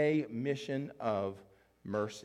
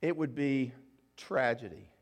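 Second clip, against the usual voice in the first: usual speaking voice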